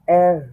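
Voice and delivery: এর with a sound between R and G; German R, এর. Shown as a demonstration. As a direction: say এর with the tongue tapping, between র and গ. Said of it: "মাঝামাঝি একটা sound".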